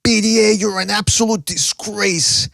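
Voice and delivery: high, slow scandalized scold; hammed-up shocked scold; mock outrage. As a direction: clutch your pearls at the host. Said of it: "Joe would say".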